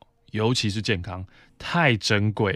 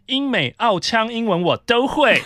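mock bragging coworker; 10 semitones higher, 5 dB louder than usual.

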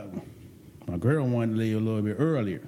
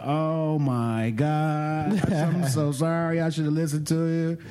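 slow, drawn-out, higher-pitched girlfriend imitation; mock gushing apology; 4 semitones higher, slower than usual. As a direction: stretch the apology out sweetly, hamming it up.

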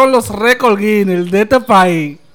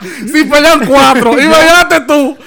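excited; 5 semitones higher, 6 dB louder than usual. Real, not acted. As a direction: build up the absurd picture. Laughing exaggeration.

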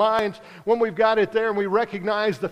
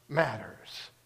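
ordinary delivery

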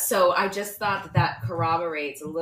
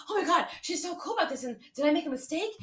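a higher, mock-panicked voice of a fretting man; panicky ex; 11 semitones higher than usual. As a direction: lightly play the frantic guy doubting himself. Friend imitating the ex panicking.

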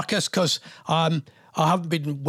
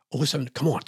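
ordinary talk, nothing marked

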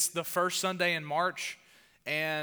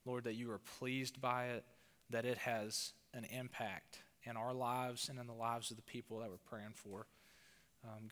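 soft, quiet prayer voice; 3 semitones lower, 8 dB quieter than usual. Acted. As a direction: pray softly and earnestly to God. Opening prayer before sermon.

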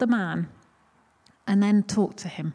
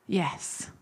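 a soft unvoiced whisper, quieter; whispered yes; 3 semitones lower, 6 dB quieter than usual. Grumpy man answers, retold.

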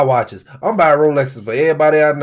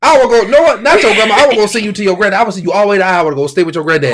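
loud, fast parental rant; hammed-up scolding father; mock angry dad threat; 6 semitones higher, 5 dB louder, much faster than usual. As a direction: threaten the kid, rushing. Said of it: "and I'm like".

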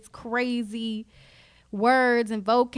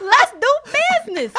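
laughing businessman; 11 semitones higher, 7 dB louder than usual. Pretend. Hammy businessman impression.

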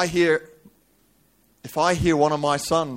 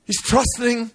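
high, raised preacher's cry; high-pitched dramatic sermon telling; impassioned preaching; 9 semitones higher, 6 dB louder, slower than usual. Acted. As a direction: preach the story at full pitch. Preaches at full force.

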